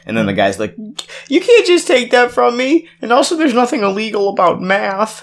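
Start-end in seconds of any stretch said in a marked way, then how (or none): 1.3-5.2 very high, indignant nerdy whine; whiny high protest. Retold or imitated. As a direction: protest like a wronged nerd. Indignant beekeeper quoted.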